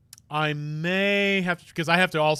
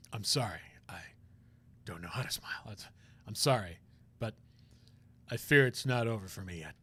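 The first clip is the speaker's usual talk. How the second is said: low, soft, hesitant whispering voice; a pained man confessing quietly; troubled prosecutor; 7 semitones lower, 8 dB quieter than usual. Acted.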